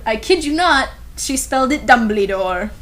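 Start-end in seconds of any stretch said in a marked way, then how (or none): none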